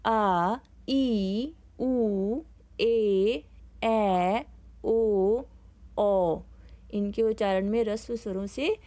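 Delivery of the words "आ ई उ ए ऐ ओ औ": आ, ई, ए, ओ each held long, with pauses between; long vowels. Shown as a demonstration. Teacher showing the pupils the long vowels. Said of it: "उच्चारण करेंगे".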